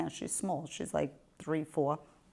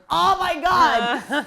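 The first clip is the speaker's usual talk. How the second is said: high, loud, drawn-out cry of exasperation; loud exclamation; 11 semitones higher, 9 dB louder, much slower than usual. Acted. Mother reacting when daughter notices her shaking, loud exclamation. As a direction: blurt it out high and slow.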